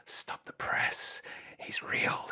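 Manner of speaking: whispered, slow, like breaking big news; hushed mock awe. Telling the drummer about the singer's bad day, in a slow whisper. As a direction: whisper it like a scandal. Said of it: "And I went".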